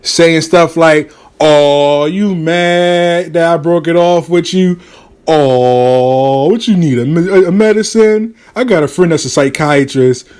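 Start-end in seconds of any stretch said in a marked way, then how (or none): 1.4-10.3 drawn-out mocking "oh" and taunts; conceited teasing. Man taunting his ex by phone, conceited teasing. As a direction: needle them with stretched, mock sympathy. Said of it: "teasing her".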